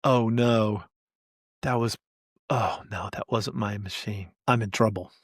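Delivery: dropping to a soft, dejected murmur; mock dismay. DBA realizing he wrecked the wrong machine, soft mock dismay. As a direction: sink into quiet mock despair as it dawns. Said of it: "you will see the sadness when he realizes".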